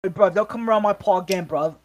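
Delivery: put-on British tough guy; mock British accent. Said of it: "like the South British people".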